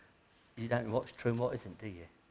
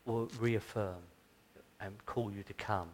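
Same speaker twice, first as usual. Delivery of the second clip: closing prayer; 2 semitones lower, 4 dB louder than usual. Acted. Preacher leading the congregation in Advent prayer, solemn and earnest. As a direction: pray over the congregation with reverence.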